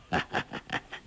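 soft low chuckle; laugh. Acted. Old beggar master amused by his young disciples, soft chuckle. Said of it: "洪七公笑道".

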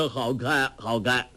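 usual speaking voice

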